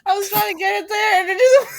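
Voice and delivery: loud, high, breaking into laughter; real laughing frustration; laughing protest. Real, not acted. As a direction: protest while cracking up. Defends self while cracking up.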